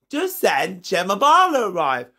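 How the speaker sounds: ordinary delivery, nothing marked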